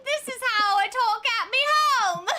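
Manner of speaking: high, loud hammed-up British-Australian accent; bad accent, indignant. Tourist insisting to customs it's real, bad accent. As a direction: protest loudly in a terrible fake accent. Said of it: "I'm not doing an accent".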